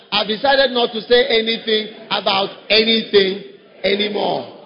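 slow, deliberate group recitation with a laugh; slow call-and-response line; said together slowly. Shown as a demonstration. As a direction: drag it out for the congregation to repeat. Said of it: "Let's say it together".

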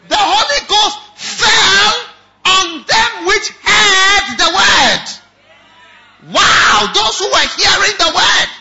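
slow, high, shouted preaching; preaching at full force. Acted. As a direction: thunder it out over the congregation.

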